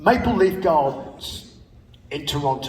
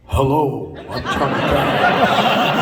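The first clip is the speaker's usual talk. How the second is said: slow, laughing mock [name], said more plainly; [name] greeting; 5 dB louder, slower than usual. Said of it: "He was like".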